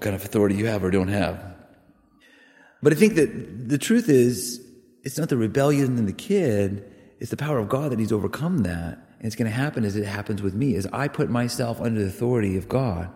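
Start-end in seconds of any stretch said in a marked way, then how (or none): none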